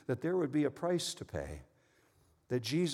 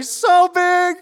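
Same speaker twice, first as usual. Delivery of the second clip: put-on very high, loud child squeal; excited toddler; 16 dB louder than usual. Toddler son answering his parents' game, excited high squeal. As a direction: shout it proudly in a tiny kid's voice. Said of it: "And Adam would say".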